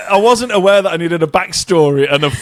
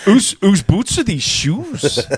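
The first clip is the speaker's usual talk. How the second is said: Welsh accent; 4 semitones higher, slower than usual. Shown as a demonstration. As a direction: draw it out like a Welsh local. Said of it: "stereotypical phrases ... Welsh accent".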